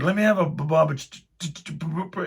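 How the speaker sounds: high stuttering attempt at a beer name; mock tongue-tied ordering; stammering. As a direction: stumble over the sounds b- and ch-. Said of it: "And I was like".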